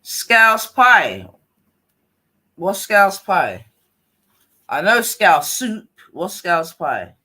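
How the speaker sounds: loud, slow, puzzled outburst; loud bafflement. Real, not acted. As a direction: draw out the words in disbelief. Baffled by a dish in chat.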